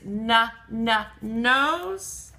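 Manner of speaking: "na, na, nose" in separate beats; first sound repeated. Shown as a demonstration. Teacher stressing the starting n, "na, na, nose". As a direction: tap the n twice before a held "nose". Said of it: "The na is at the beginning".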